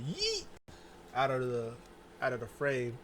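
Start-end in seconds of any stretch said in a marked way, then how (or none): 0.1-0.5 high squeaky Yeet. Retold or imitated. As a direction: fling it out in a falsetto whoop. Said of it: "She said".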